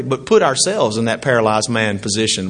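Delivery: ordinary talk, nothing marked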